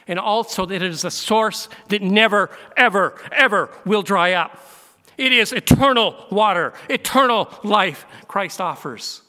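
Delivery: loud, climbing preacher's cry on never, ever, ever; preaching at full force. Acted. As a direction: proclaim it, building to the peak. Preacher climaxing on eternal water.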